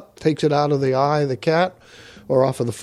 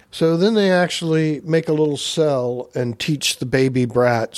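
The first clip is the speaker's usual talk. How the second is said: normal talking voice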